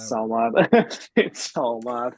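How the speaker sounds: slow, drawn-out words through laughter; real amused laughter. Real, not acted. Podcast guest reacting to shared school-morning nostalgia, laughing. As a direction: laugh while marvelling at it.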